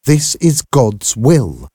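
slow, stern pronouncement; cold. A narrator's reading. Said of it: "My mother's response was cold".

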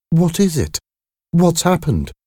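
high-pitched anxious questions; nervous. A narrator's reading. Mother pressing the visitor for news, nervous. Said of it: "she asked nervously".

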